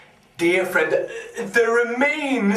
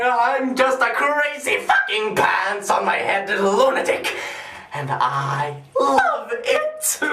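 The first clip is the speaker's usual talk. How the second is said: giddy raving with laughter breaking in; manic glee; 3 semitones higher than usual. Acted. Madman proudly admitting lunacy to the doctor, manic glee. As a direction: revel in being completely mad. Gleefully drops the sane act.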